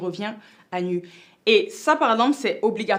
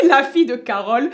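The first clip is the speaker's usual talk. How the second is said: laughing; 5 semitones higher, 8 dB louder than usual. Real, not acted. Laughs at her own accent.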